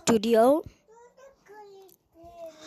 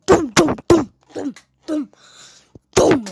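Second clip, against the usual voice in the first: higher, louder thumping beats; belt thuds; 5 semitones higher, 3 dB louder than usual. Retold or imitated. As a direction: beat out the belt blows. Imitating belt blows.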